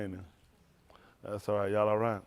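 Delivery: normal talking voice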